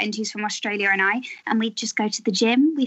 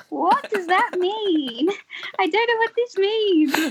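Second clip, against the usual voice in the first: baffled; 8 semitones higher, slower than usual. Retold or imitated. Retells baffled reaction to slang.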